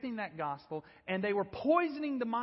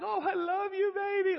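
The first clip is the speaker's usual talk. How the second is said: put-on much higher, sugary baby-talk voice; mock tender coddling; 11 semitones higher than usual. Mocking the tenderness the coach never showed, cooed high. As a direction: coo it like doting over a baby. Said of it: "there was none of that".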